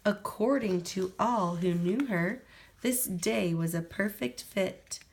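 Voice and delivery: ordinary delivery, nothing marked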